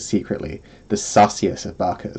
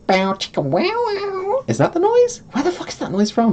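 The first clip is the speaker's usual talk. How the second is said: high mock sound effect. Imitating a sexy music sting.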